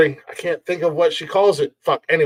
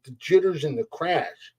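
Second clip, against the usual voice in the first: normal talking voice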